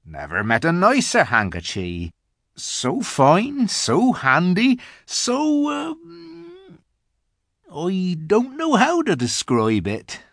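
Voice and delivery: simple giant climbing high in delight; high, wondering giant voice; polite, admiring giant. A narrator's reading. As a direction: grope for praise, trailing into an um. Giant praises the handkerchief.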